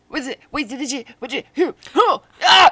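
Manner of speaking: rising frantic yell; panicked outburst. Retold or imitated. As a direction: build to a panicked cry. Retold panic watching show.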